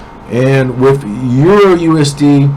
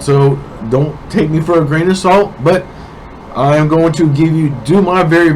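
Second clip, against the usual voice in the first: normal talking voice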